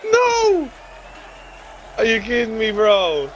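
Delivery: frantic rant. Real, not acted. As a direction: blurt the excuses in disbelief. Ranting after losing the fight.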